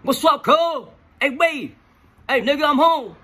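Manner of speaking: imitating a friend yelling from down the hall; retold yelling. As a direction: holler it across the cell block. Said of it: "this motherfucking yelling down the hall".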